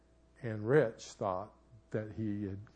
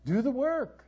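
emphatic command; 13 semitones higher, 7 dB louder than usual. Real, not acted. Preacher urging couples.

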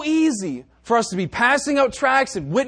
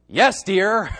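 louder, emphatic YES dear with a laugh; pointed yes dear; 4 dB louder than usual. Shown as a demonstration. Showing the congregation the contrasting tone, pointedly. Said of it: "there's a great difference between".

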